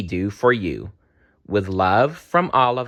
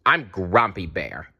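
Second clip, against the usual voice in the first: ordinary delivery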